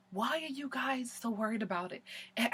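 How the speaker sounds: very soft, meek mimicry; mock guilt-tripping. Katie guilting friends at her bridal shower, mock guilt-tripping. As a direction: imitate a guilt trip in a tiny, hushed voice. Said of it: "tries to come in with the guilt".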